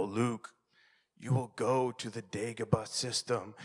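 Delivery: low, softened movie-character voice; hushed Star Wars whisper. Retold or imitated. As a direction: imitate a solemn mentor murmuring to an unborn child. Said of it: "I would whisper to him".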